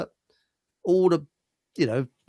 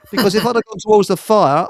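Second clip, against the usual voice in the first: normal talking voice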